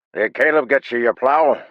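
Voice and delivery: high, loud friendly call; hearty blacksmith. A narrator's reading. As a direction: greet John like a booming tradesman. Blacksmith Garrett speaks.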